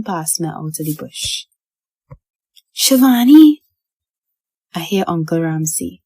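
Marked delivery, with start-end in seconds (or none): 2.7-3.6 loud, high tearful call; choked-up cry. A narrator's reading. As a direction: call out in relief through tears. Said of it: "choked up again, crying".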